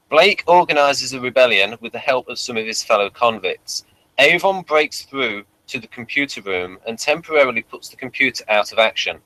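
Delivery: normal talking voice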